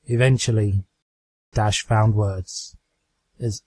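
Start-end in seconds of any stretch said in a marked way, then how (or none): none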